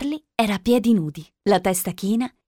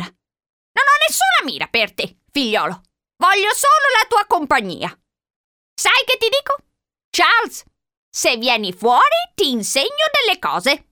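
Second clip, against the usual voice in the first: put-on high-pitched old-lady voice; old woman coaxing; 9 semitones higher than usual. A narrator's reading. Old woman luring the boy out, coaxing. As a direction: coax up high with promises.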